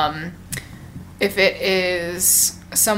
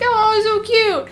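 very high, slow gushy coo; high, stretched adoring squeal; baby-talk coo; 13 semitones higher, slower than usual. Retold or imitated. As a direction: coo over a baby, drawn out. Cooing at the baby, retold.

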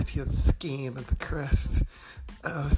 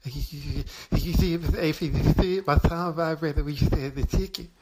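excited; 4 semitones higher, 3 dB louder than usual. Real, not acted. Excited tongue bit, laughing.

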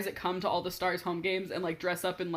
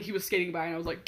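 ordinary delivery

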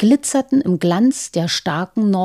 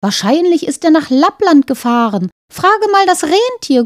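talking bird voice; 8 semitones higher, 4 dB louder than usual. Acted. Talking bird in fairy tale.

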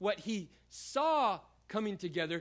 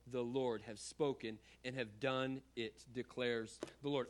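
measured, lower reading voice; scripture reading; 4 semitones lower than usual. Acted. Pastor reads Ezekiel to the congregation, measured and grave. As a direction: read the passage to the congregation with weight.

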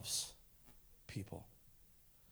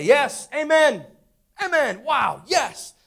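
mock amen; 9 semitones higher, 10 dB louder, much slower than usual. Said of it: "And we say".

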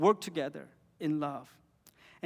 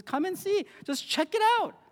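much higher, light easygoing invitation; Jesus inviting casually; 13 semitones higher than usual. Retold or imitated. Jesus casually inviting the seekers, high and easygoing. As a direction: toss it off like a friendly shrug, pitched way up. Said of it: "And Jesus simply says".

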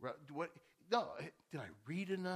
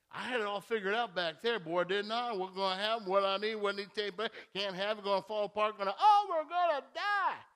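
mock panic; 3 semitones higher, 9 dB louder than usual. Preacher mocking his anxious self, building to mock panic. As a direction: build your worry until it breaks into fake terror.

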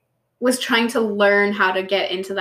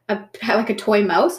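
normal talking voice

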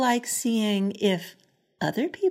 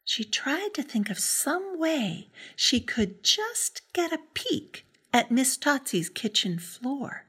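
plain reading voice

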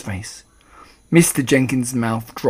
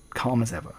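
usual speaking voice

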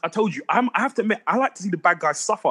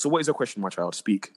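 a hint of a sage storyteller; rambling grandpa story; 8 semitones lower, 5 dB quieter than usual. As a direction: lower and softer, mock wise elder. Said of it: "Nah, jokingly".

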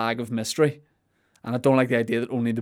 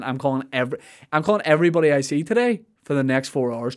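ordinary delivery

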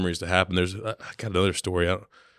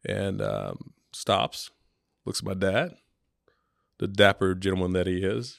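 ordinary delivery

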